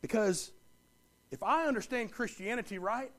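ordinary talk, nothing marked

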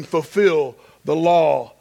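ordinary delivery

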